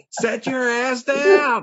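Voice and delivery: high, slow, laughing yell; mock scream. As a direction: yell it at the screen, cracking up.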